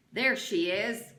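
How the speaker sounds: loud slow accusing shout. A narrator's reading. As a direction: bellow it at the crowd. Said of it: "Trout shouted".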